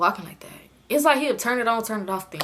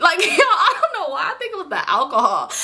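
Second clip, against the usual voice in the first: talking through real laughter; amused giggle while explaining; laughing; 7 semitones higher than usual. Real, not acted. Laughing at herself.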